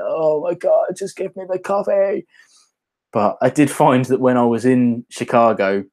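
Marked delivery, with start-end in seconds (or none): 0.0-2.3 high, hammed-up pleading whine climbing higher; retold impatient plea for coffee, pitched up comically; mock exasperation. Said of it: "And I was just like".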